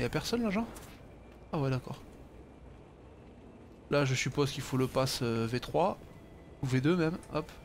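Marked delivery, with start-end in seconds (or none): none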